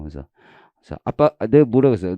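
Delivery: normal talking voice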